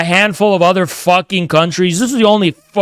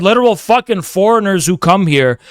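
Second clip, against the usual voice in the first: ordinary delivery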